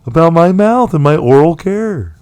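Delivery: high-pitched put-on voice of mock concern. Telling co-host of mouth worries, high put-on voice. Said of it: "What was that voice?".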